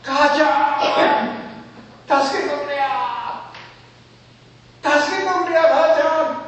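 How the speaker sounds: high, loud, desperate plea to mother; anguished high shout for help; crying out to mother. Retold or imitated. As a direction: call for mother at the top of your voice, pleading. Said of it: "大きな声で".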